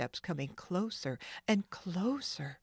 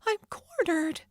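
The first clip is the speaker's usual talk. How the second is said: high, louder cornered cry; despairing Nancy; 13 semitones higher, 5 dB louder than usual. Acted. Nancy realizes she is cornered, despairing. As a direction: sound trapped and scared. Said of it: "Nancy thought in despair".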